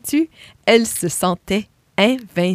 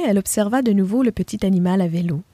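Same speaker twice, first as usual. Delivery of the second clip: plain reading voice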